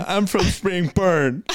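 high, slowly drawn-out boast; comic put-on declaration; mock bam. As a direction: stretch it out in a silly high voice. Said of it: "I am a bam".

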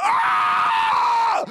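very high, loud scream; hammed-up labour shriek; high-energy mock scream. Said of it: "We need to keep this energy up".

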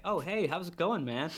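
lightly imitated chirpy, friendly customer; animated greeting. Odd regular greets the barman, animated. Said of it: "and he's so animated".

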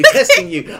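testing you squealed high and laughing. Shown as a demonstration.

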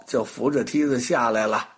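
ordinary delivery, nothing marked

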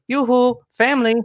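imitated high, lilting Norwegian greeting; child mimicking Oaken. Daughter shakes dad awake, high singsong mimicry. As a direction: call it out very high, mimicking a kid's impression. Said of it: "impersonating a bit character in a Disney movie".

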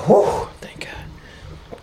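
slow unvoiced whisper of relief; whispered relief, barely voiced; relieved whisper. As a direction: breathe it out, slow and soft. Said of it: "It's like".

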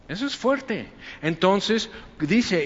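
ordinary talk

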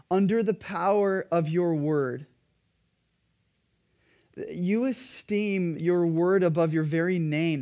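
normal talking voice

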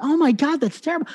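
high, startled "that's terrible"; a quick high jolt of dismay; retold shocked gasp. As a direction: pitch up in mock shock at the news. Retold shock at colleague fired.

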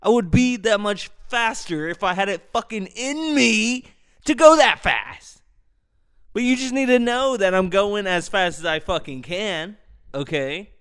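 high-pitched angry comeback; angry retort. Retold or imitated. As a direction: fire back, fed up and heated. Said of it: "I'm like".

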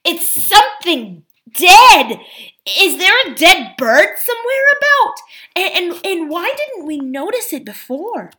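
high-pitched, alarmed revulsion; gasping in disgust. Acted. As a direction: start loud and high, settling into high, halting bewilderment. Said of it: "gasped Eustace".

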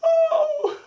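high, loud hammed-up weeping that breaks into laughter; fake-cry it for the joke, then crack up; mock sobbing. Pretending to weep over movie.

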